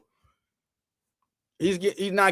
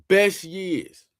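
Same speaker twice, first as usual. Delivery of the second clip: ordinary talk, nothing marked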